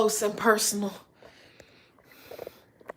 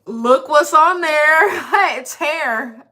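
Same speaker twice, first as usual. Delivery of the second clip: excited; 6 semitones higher, 7 dB louder, slower than usual. Real, not acted. Amazed at wax strip result.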